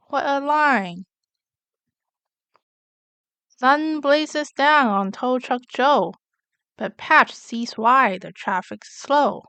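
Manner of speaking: usual speaking voice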